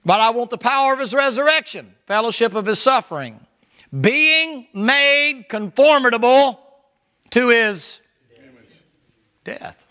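high, loud, drawn-out preaching climax; preaching at full force. Acted. Preacher lifting the verse to the congregation, ringing high. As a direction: build the verse to a ringing peak.

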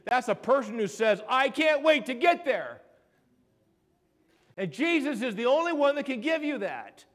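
high, loud, exultant preacher; fired-up sermon delivery; impassioned preaching. Real, not acted. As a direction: proclaim it to the whole congregation. Preaches at full force.

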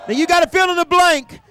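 normal talking voice

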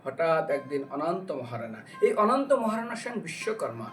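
usual speaking voice

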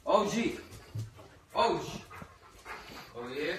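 high, loud call to the dog. Real, not acted. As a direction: call the dog's name loudly. Calling the dog over.

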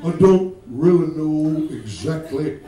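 low, slow Mississippi preacher; halting Southern preacher drawl; Jimmy Swaggart. Acted. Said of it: "Jimmy Swaggart had that".

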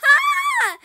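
very high long shriek; shrieking laugh-scream. Real, not acted. Player fleeing the mother, high shriek of panic. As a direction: shriek as she attacks. Mother coming after her.

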